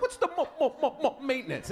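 high-pitched stammer stuck on "maintenance"; worsening stutter. Retold or imitated. Comic mimics the client asking about fees, stuttering high. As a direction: imitate a man blocking hard on the M. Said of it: "the worse the stutter gets".

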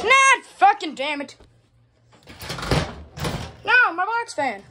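high, loud, drawn-out cursing; exasperated high wail; dismayed outburst. Real, not acted. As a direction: cry out in dismay, pitch jumping up. Fan falls, speaker cries out.

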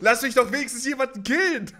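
high loud whiny plea; real frustrated shouting; frustrated yell. Real, not acted. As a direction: beg the game for one kill.

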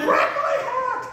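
very high mock shriek; urgent high-pitched yelp, played for laughs; retold shriek. Storyteller repeating his plea to puzzled onlookers, high shriek. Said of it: "And I shrieked".

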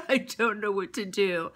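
slow, softened mock weeping; mock tearful JoJo. JoJo crying on the tarmac, slow mock-tearful plea. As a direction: play a lost, teary girl, half-hearted.

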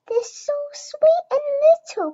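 put-on high, breathy baby-talk whisper; doting high whisper; cooing whisper. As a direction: coo it over the babies in a high whisper. Peppa coos over baby twins.

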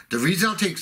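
ordinary talk

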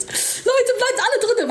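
high-pitched plea to the viewers; high pleading. Real, not acted. Streamer begging viewers to stay in the stream, high.

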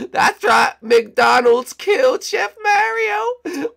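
high, slow gloating; gloating villain. Acted. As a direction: savour the murder, slowly and high.

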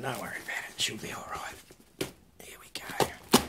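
whisper. Real, not acted. Telling Pete the mangled box is fine, whispered.

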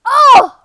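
loud, high yelp of shock; startled cry. Real, not acted. Player shocked by the game, loud startled cry. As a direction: cry out, caught off guard.

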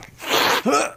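high unvoiced gasp of pain; sharp pained gasp. Man hit by a cat's punch, sharp gasp. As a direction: suck in air as the paw hits.

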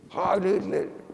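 thin-volume, high re-enacted salute; imitated salute. Re-enacts the small boy's salute.

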